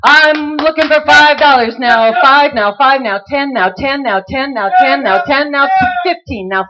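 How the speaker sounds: rapid rhythmic bid chant; auctioneer. Acted. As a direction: rattle off bids like an auctioneer.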